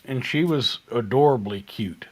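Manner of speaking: ordinary talk, nothing marked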